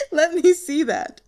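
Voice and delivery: high and loud, still laughing; amused. Acted. Colleague reaching for the baton, still laughing. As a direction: ask through the last of the laugh.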